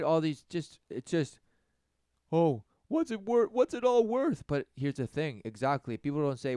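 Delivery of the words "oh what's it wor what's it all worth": higher, whining mock despair; high mocking whine; mock whiny lament. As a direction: whine it like a sulky teen.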